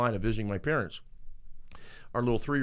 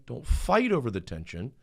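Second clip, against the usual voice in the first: ordinary talk, nothing marked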